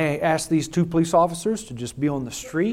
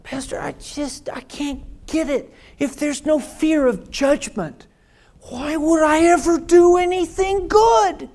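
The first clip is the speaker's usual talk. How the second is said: higher, pleading voice of a doubter; imitating a worried congregant; 12 semitones higher, 5 dB louder than usual. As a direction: voice the anxious churchgoer. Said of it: "Someone says".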